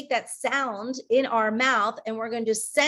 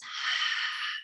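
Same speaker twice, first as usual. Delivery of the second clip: long exhale; 5 dB quieter than usual. Shown as a demonstration. Breathing out with the class.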